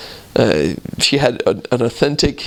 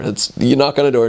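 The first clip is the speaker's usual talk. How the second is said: normal talking voice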